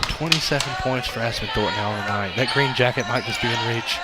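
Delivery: soft hushed golf announcer; golf commentator. Acted. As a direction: murmur it like a Masters broadcast. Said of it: "your golf announcer voice".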